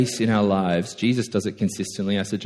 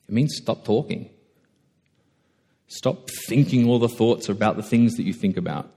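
ordinary delivery

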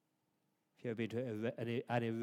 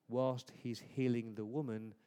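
ordinary talk

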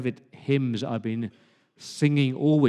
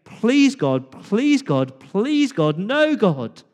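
high, pleading cry to God; a father's frantic plea over his son; desperate prayer; 6 semitones higher, 4 dB louder, much slower than usual. Retold or imitated. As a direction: beg God in panic.

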